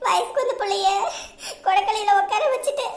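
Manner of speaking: sobbing, climbing to a high wail; tearful daughter pleading with her mother; crying. Acted. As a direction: pour out helplessness in tears. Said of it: "ஏம்மா அழுகுற?".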